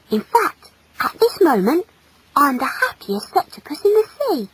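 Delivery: put-on high, young, blissful voice; high-pitched boyish contentment; happy boy. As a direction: declare it with shy joy. Happy boy declares his joy.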